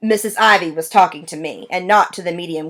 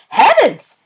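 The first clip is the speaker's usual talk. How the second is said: high, louder gasp of a word; shocked lady exclaims; startled exclamation; 10 semitones higher, 4 dB louder than usual. A narrator's reading. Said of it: "Missus Ivy shrieked".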